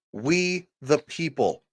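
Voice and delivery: slow, weighty "We the people."; solemn quote. Shown as a demonstration. Streamer quoting the Constitution to chat, slow and weighty. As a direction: lay out "We the people." word by word, slowly. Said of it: "That's how the document starts".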